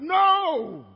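loud, high drawn-out refusal; defiant shout. Acted. Believer standing up to Satan, defiant shout. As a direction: throw it out as a firm shout.

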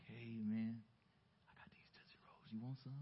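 furtive hushed offer; sneaky whisper. Retold or imitated. Boy slipping stolen candy to his friends, sneaky whisper. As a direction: whisper it like passing contraband.

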